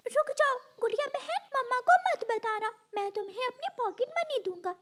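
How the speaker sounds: high, childlike cartoon voice; pleading boy bird. Brother begging sister not to tell mother, pleading. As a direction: coax her with a bribe.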